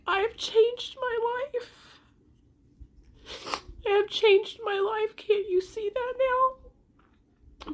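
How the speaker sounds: high, drawn-out tearful plea; crying. Real, not acted. Mother begging critics to see she changed, crying. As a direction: plead slowly through real tears. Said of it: "The reason why I'm crying".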